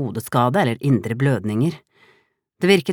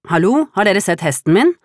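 higher, louder call from afar; 7 semitones higher, 6 dB louder than usual. A narrator's reading. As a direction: shout the question while running up. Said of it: "ropte landhandleren".

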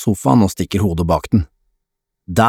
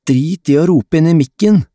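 raised, higher-pitched annoyance; irritated snarl; 8 semitones higher, 4 dB louder than usual. A narrator's reading. Lukas scolds Adam for shouting into mic, snarling. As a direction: snap at a friend over the noise. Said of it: "snerrer Lukas".

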